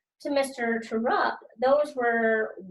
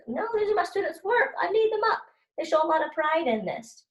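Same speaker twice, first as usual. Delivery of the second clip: high, firm voicing of the teacher; acted teacher refusal; 8 semitones higher than usual. Teacher refusing the fire marshal, lightly voiced. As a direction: stand up for the students' work.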